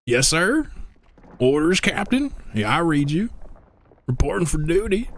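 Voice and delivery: high-pitched Southern soldier; crazy Southern accent. Acted. Streamer imitating the tank driver, high Southern accent. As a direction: report in with a high, exaggerated Southern twang. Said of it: "got a southern accent, like, crazy".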